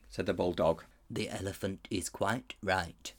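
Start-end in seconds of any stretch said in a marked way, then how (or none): 1.1-3.1 much softer, slow tapir. A narrator's reading. Tapir quietly siding with the elephant. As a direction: mumble it like a meek tapir.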